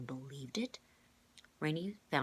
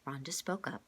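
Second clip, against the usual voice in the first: ordinary delivery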